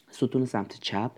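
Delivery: ordinary talk, nothing marked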